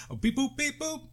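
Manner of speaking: high beep-boop dialing noises; phone beeps. Pretend. Mimicking phone dial tones.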